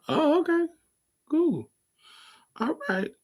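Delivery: a sweet put-on high oh okay; high mock okay. Her reply to the boy.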